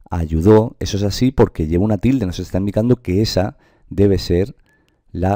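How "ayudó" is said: "ayudó" with a strong, rising final "dó"; force on last syllable. Shown as a demonstration. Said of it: "recaer la fuerza en la última".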